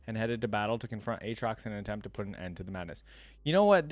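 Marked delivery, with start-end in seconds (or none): 3.4-3.9 sudden excited idea. Real, not acted.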